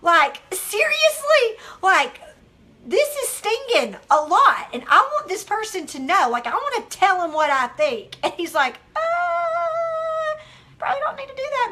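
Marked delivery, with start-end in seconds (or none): 0.0-8.2 louder, higher retold outrage; indignant re-enactment, punching key words; fired up. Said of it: "I was fired up". 9.0-11.7 long high "uh", then high-pitched advice; singsong, pitched-up mock caution; imitated hesitant adviser. Adviser's hesitant reply, acted out.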